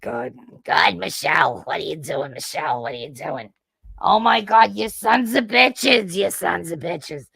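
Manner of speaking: put-on thick Jersey accent, building to an exasperated cry; mother's thick Jersey accent. Imitating her mother scolding, way thicker Jersey accent. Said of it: "my accent is way thicker".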